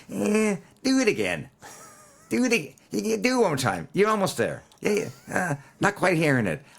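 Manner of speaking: a coaxing producer with a hammed-up Long Island accent. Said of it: "I'm trying to do his Long Island accent".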